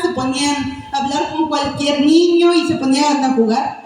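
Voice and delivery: usual speaking voice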